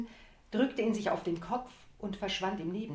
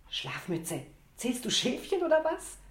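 much higher, louder playful jab; cheerful teasing; 6 semitones higher, 3 dB louder than usual. Acted. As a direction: tease her friend brightly. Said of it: "fragte sie überraschend fröhlich".

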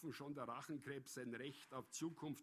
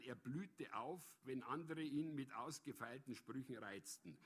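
usual speaking voice

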